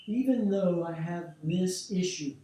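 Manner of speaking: loud, slow setup phrase; spoken with energy. Shown as a demonstration. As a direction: call it out slowly and boldly. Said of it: "speak it with energy".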